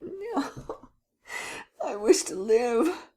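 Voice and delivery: high, slow tearful plea; frightened Gérard begs for his life, sobbing. Acted. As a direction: plead through tears.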